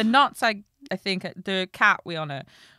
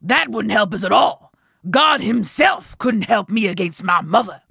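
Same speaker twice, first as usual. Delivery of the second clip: loud, emphatic miller; miller's voice; 3 semitones higher, 11 dB louder than usual. A narrator's reading. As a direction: protest at full volume as the miller. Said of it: "said the miller".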